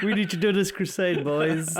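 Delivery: lightly mocked king rallying troops; Charles. Imagining the king rallying his boys, mock rallying call. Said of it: "Charles would come and be like".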